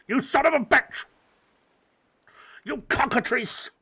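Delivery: furious curse at the lizard; angry outburst. Real, not acted. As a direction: yell in frustration. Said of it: "I'm so angry about that".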